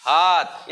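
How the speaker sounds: हाथ with a long aa; teacherly, sounded-out हाथ. Shown as a demonstration. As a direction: stretch the middle of हाथ. आ की मात्रा लगाएंगे.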